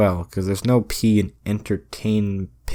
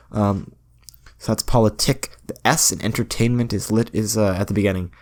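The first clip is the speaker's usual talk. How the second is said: ordinary talk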